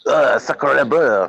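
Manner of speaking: slow, overdone French sacre bleu; bad French accent. Joking friend drags out a cartoon French exclamation, badly accented. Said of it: "you get the accent completely wrong".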